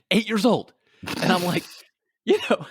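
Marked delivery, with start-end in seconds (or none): none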